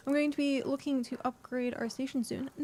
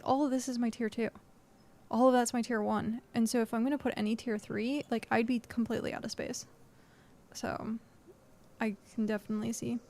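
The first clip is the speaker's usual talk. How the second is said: ordinary delivery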